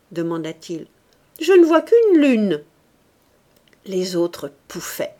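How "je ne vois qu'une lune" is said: higher, louder teasing voice; mocking joke. A narrator's reading. Captain mocking Mouret's back, higher teasing voice. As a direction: land the gag for the bench.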